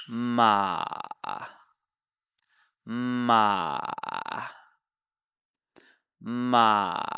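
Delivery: each MA sags low like a frog croaking; dipping third tone. Shown as a demonstration. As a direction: start ma midway, then dip into a croak. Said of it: "kind of like a frog croaking".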